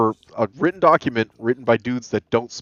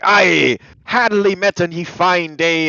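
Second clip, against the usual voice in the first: slow, high, louder pirate accent, hammed up; 8 semitones higher, 5 dB louder, slower than usual. Shown as a demonstration. Showing the friend how old texts read, in a pirate accent. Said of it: "when you do it like".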